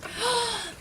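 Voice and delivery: sharp high gasp; surprised gasp. Acted. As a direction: gasp in shock. Shocked reaction.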